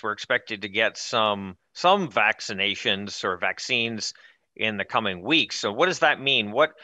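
normal talking voice

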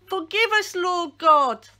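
crying out. A narrator's reading. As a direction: cry out to God in desperation. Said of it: "they cried".

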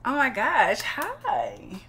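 high, loud gushing hello; over-the-top thrilled to meet them; excited greeting. Acted. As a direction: greet them with a delighted squeal. Character greets new housemates excitedly.

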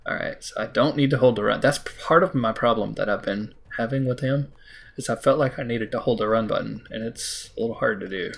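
ordinary talk, nothing marked

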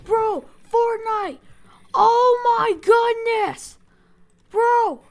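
loud, high panicked cries; panicked shouting. Real, not acted. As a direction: yell out in alarm as you flee. Panics as the game villain attacks.

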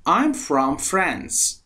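very slow, clear English sentence; slow model answer. Shown as a demonstration. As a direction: say it slowly so they can copy.

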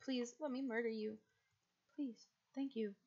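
ordinary talk

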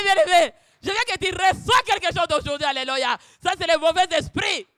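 shouted, high preacher's call; fiery sermon cry; preaching at full force. Acted. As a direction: rouse the congregation.

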